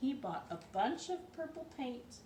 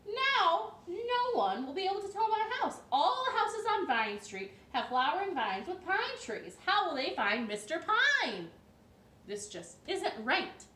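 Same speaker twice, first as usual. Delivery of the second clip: loud, higher exasperated protest; upset homeowner, loud and high; distressed cry of no; 8 semitones higher, 9 dB louder than usual. A narrator's reading. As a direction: wail that everyone copied him. Mister Pine protests, neighbors copied.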